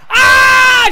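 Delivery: high, loud mock shriek; imitated scream. Dana sitting up after the three count, a mock shriek. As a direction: let out a hammed-up shriek of outrage. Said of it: "She screams at the top of her lungs".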